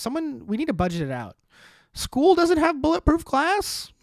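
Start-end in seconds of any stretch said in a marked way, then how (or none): 1.9-3.9 high, slow, incredulous question; mock disbelief. Comic host on school glass, mock disbelief. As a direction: stretch it out in fake shock.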